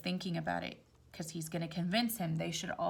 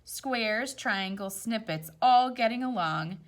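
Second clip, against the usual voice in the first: gentle storybook read-aloud; rhyming story voice; reading to children; 4 dB louder than usual. A narrator's reading. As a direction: read it like a picture book at bedtime. Reads picture book aloud.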